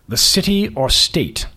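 put-on slow, lofty prep-school British accent; slow aristocratic accent. Boss questioning the candidate slowly in an aristocratic accent. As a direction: savour each word like a bored aristocrat. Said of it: "His accent was patently aristocratic".

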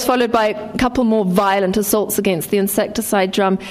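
plain reading voice

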